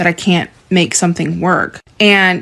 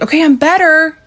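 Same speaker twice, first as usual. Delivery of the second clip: high, falsely bright; forced reassurance; high mock-cheerful self-assurance; 9 semitones higher than usual.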